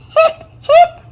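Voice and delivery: two high squeaky hiccups, near tears; whimpering hiccups. Acted. Girl still hiccuping before the play, high teary hiccups.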